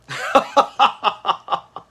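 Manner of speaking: breathy unvoiced laugh; real laughter at the show. Real, not acted. Laughing while watching the show.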